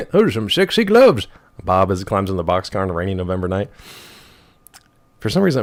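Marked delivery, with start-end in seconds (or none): 0.1-1.3 movie quote. Acted. Quoting a movie line.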